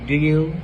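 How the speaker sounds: "do you" run together as one smooth word; linking. Shown as a demonstration. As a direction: glide from "do" into "you" without a break. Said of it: "linking. Smooth transition between words".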